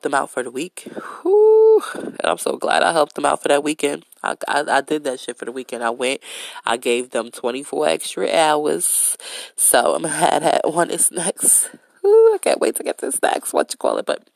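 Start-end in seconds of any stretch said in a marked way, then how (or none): 1.2-1.8 a loud high whoosh of relief; big relieved exhale; relieved whew. Real, not acted. Exhale of relief before continuing. 12.0-13.5 much higher and louder, giddy; gleeful, high-pitched anticipation; excited squeal. Real, not acted. Excited aside about upcoming topic.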